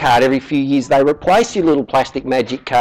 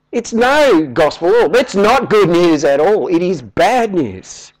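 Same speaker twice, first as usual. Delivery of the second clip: ordinary talk, nothing marked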